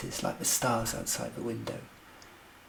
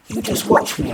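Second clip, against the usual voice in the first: loud, husky and amused; laughing boast; 10 dB louder than usual. A narrator's reading. Narrator shows off letter writing to co-stars, laughing. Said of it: "I said, laughing".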